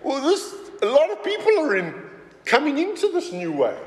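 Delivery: high-pitched, agitated retold complaint; mock alarm. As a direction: imitate rulers getting worked up. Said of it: "what was upsetting the Romans".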